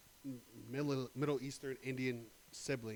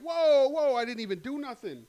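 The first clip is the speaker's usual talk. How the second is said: much higher, very loud panicked protest; protesting innocence; 11 semitones higher, 14 dB louder than usual. Acted. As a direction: plead that you did nothing wrong. Acting man protesting innocence.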